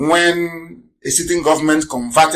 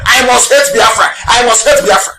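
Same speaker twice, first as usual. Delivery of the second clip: loud, chanted mock pledge, higher; mock morning creed; 6 semitones higher, 3 dB louder than usual. Mocking opponents reciting their hate creed, loud chant. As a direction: shout it out like a drilled daily oath. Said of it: "as if they sing it as a creed every morning".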